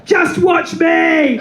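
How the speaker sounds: high, slow, stretched-out shout; defiant shout. Retold or imitated. Singer at karaoke defying her friends, drawn-out high shout. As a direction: bellow it back at your friends. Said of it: "And I'm going".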